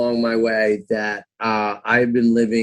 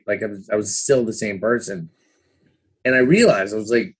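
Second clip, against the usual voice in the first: ordinary delivery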